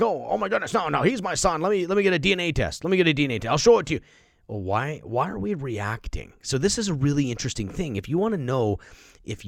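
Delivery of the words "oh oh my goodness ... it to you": mock defensive father. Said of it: "I'd be like".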